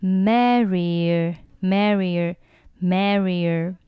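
slow "merrier" with a y between the vowels; drawn-out "merrier", vowels joined by y; y-glide linking. Shown as a demonstration. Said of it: "中间呢会加上一个也的半元音".

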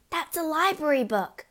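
put-on high, distressed girl's protest; anguished cry. A narrator's reading. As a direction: cry out in alarm. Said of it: "Matilda cried".

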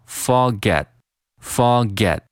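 "forget" for fog at, the g running into at; fog at linked. Shown as a demonstration. Teacher linking fog and at, said slowly as "forget". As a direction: blend fog and at into one smooth "forget". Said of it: "fog和at进行连读".

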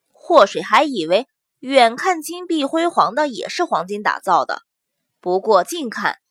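usual speaking voice

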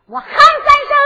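high, loud proclamation; bold announcement. Acted. Captive Liu Xiu warns Su Long, high defiant shout. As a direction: ring it out high over the captor.

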